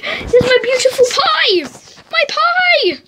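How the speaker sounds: high, slow delighted cry; excited. Real, not acted. Finds lost pie.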